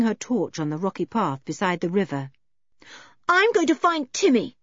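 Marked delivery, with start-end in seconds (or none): 3.3-4.6 put-on high, determined child's voice; raised, resolute tone; defiant. A narrator's reading. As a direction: announce it, brooking no argument. George defiantly sets off.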